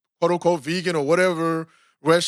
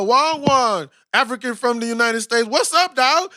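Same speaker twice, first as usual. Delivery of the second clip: a lightly Jamaican-flavoured hearty hello, pitched higher; Jamaican greeting; 4 semitones higher than usual. Pretend. Host imagines Jamaican staff welcoming him, in a light hearty Jamaican lilt. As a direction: greet a fellow African like an old friend. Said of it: "I want y'all to be like".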